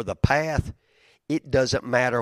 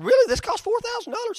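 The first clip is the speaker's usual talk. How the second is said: disbelief. Retold or imitated. Quoting his disbelief at cost.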